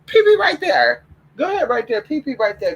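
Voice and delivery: high sing-song coaxing a puppy; high pet-talk encouragement; baby talk to dog. Acted.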